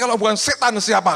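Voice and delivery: high, loud retold outburst; hammed-up indignant yelling; indignant shouted retort. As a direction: protest at full volume, acting exasperated.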